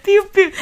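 put-on baby chick peeps. Chick song sound.

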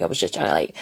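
usual speaking voice

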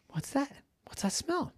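high, soft, rising questions; puzzled wondering. A narrator's reading. Ducky smells something while flying, puzzled and wondering. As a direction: ask in a small high voice, curious.

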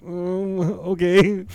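high, pained whimper, imitated; hammed-up tearful acceptance; mock crying. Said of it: "Hurting, all crying and shit".

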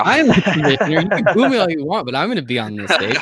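sneering heel taunting a booing crowd; laughing, taunting like a wrestling villain; NFL commissioner. Pretend. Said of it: "He's a commissioner now".

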